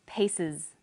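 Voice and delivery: "pieces" ending in a clear voiced IZ; final IZ demonstrated. Shown as a demonstration. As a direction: model "pieces" carefully, ending on IZ. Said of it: "S on the end is pronounced z".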